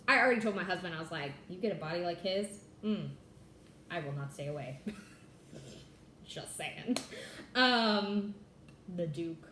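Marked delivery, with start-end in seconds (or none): none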